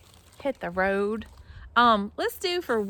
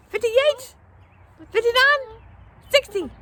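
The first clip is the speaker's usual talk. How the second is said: much higher, louder questioning count; coyote voice; 14 semitones higher, 7 dB louder than usual. Shown as a demonstration. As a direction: yip each number like an alert coyote. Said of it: "get your cute little coyote ears up".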